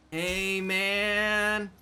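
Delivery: slow, high stoner drawl; drawn-out, spaced-out dog voiceover. Acted. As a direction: voice the puppy as a blissed-out stoner. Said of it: "your dog sound like a stoner".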